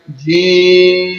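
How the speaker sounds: جیم stretched very slow, the vowel held long; جیم held like a recited note; drawn out. Shown as a demonstration. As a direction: pull جیم out long and even. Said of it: "تھوڑا سا کھینچ کر پڑھیں گے ہم".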